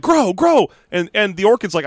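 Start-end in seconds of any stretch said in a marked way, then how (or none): 0.0-0.7 high-pitched repeated mock order; pitched-up, pushy chant; insistent command. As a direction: imitate someone urging a plant on. She keeps going like.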